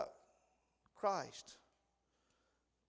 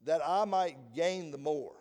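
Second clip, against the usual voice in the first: scripture reading; 6 dB louder than usual. Acted. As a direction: read the verses out solemnly. Reads scripture aloud.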